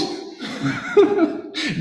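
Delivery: real amused laugh, high; light high burst of laughter. Real, not acted. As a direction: laugh at your own joke. Laughs at own imagined reaction.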